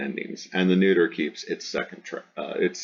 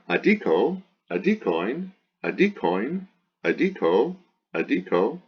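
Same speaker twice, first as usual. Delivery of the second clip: high singsong chant of word forms, each swinging up; paradigm recitation; 6 semitones higher than usual. Shown as a demonstration. Teacher reciting the declension, high singsong drill. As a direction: drill each form separately with an exaggerated pitch accent.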